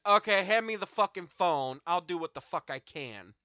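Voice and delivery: pissed-off retold demand; angry. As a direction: demand the phone in annoyance. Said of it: "that pissed me off".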